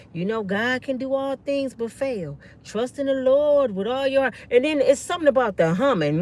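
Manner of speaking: normal talking voice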